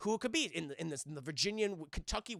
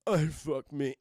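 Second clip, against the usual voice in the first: low, put-on seductive creep voice; lower-pitched parody of creepy seduction; Buffalo Bill; 4 semitones lower than usual. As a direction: imitate a self-admiring movie villain. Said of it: "Buffalo Bill dance".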